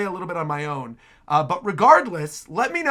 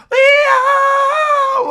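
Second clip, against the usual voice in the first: loud, very high sustained yell; piercingly high held cry; imitated battle cry; 10 dB louder than usual. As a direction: mimic a long high-pitched power-up scream. Imitates shout heard in demo.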